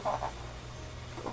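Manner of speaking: souffle agacé, sans voix; oh soufflé. Real, not acted. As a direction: expire le oh, à peine audible. Case qui s'ouvre mal, agacée. (oh chuchoté, agacé)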